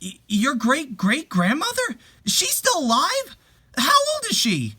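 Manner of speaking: stunned, stammering at first; shocked disbelief. Acted. Wife learns the great-great-grandmother lives, in shocked disbelief.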